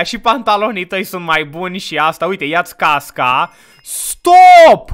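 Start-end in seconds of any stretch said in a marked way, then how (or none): 3.8-4.9 loud, high yell to halt; alarmed shout. Real, not acted. Player stopping the partner mid-action, shouting in alarm.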